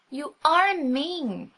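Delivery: put-on high, slow, childlike accusation; child's reproach. A narrator's reading. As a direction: drag it out like a hurt little girl. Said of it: "she cried".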